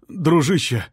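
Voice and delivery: high, loud pleading cry for help; desperate plea. A narrator's reading. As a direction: beg a stranger, voice cracking high. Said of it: "взмолился он".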